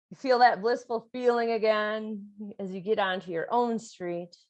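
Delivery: usual speaking voice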